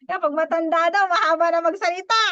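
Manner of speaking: laughing tease. Real, not acted. Teasing about old speakers.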